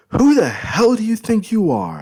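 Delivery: screamed high and loud in rage; furious shout. Acted. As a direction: yell it at the intruder, full fury. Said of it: "I shout".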